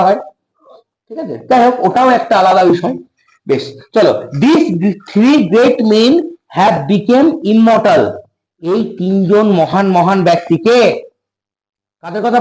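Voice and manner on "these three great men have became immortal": slow dictation. Shown as a demonstration. Teacher dictating English slowly.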